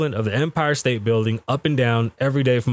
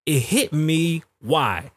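ordinary talk

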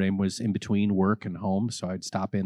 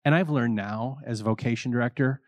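usual speaking voice

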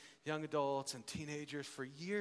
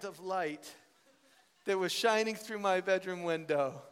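heightened romantic storytelling; 5 semitones higher, 6 dB louder than usual. Real, not acted. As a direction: tell it like a love story, hammed up. Heightened storytelling of love dawning.

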